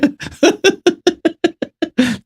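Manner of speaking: a high burst of scornful laughter; mocking laugh. Real, not acted. Debater laughing at his opponent's argument, scornful laugh. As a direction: laugh at how absurd the claim is.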